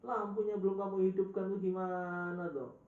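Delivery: loud, high-pitched telling-off; scolding shout. Retold or imitated. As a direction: imitate an exasperated teacher scolding a student, name drawn out. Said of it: "dibentak itu Pak Syekh Himri".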